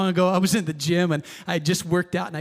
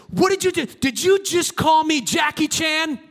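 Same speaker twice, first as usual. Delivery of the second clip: mock furious yell; 8 semitones higher than usual. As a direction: hurl the accusation like a furious stranger. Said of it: "he's just fuming".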